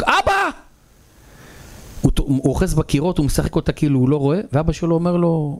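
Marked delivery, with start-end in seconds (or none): 0.0-0.5 high-pitched yearning cry; very high, narrated outcry; dying to shout. A narrator's reading. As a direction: call out to Dad as if bursting to shout. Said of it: "הוא מת לצעוק".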